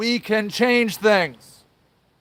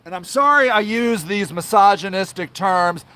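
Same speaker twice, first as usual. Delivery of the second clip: ordinary delivery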